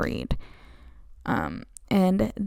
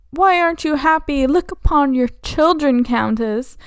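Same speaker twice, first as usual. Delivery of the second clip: mock pleading; 6 semitones higher, 3 dB louder than usual. Newlyweds pleading with the Countess.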